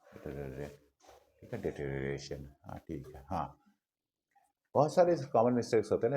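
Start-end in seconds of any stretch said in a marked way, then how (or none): 0.0-0.8 low, soft drill of the word, picking up speed; practice repetitions speeding up. Shown as a demonstration. Teacher shows students how to practise, quiet repetitions gathering speed. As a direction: repeat it quietly to yourself, gradually quicker. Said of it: "फिर speed बढ़ाइए".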